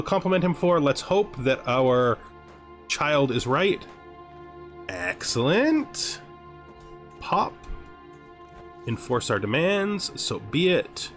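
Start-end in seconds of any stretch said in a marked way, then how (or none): none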